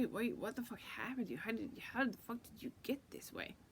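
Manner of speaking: ordinary talk, nothing marked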